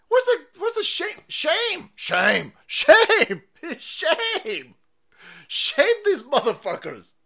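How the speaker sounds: loud, high repeated cries of shame; shouted shaming. Real, not acted. Chanting shame at opponents.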